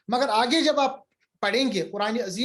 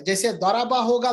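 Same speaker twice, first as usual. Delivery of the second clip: ordinary talk, nothing marked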